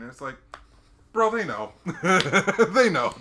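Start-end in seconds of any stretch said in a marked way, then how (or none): 1.1-3.1 laughing exclamation. Real, not acted. Laughing disbelief at Army training.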